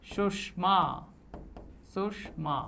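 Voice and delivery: सुषमा, retroflex sh; retroflex sh demo. Shown as a demonstration. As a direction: curl the tongue for the ष in सुषमा. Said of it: "जीभ अंदर की तरफ मुड़ेगी".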